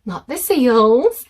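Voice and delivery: bursting with happy pride; delighted. A narrator's reading. Edward realises the visitor means him, thrilled.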